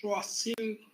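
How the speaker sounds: ordinary delivery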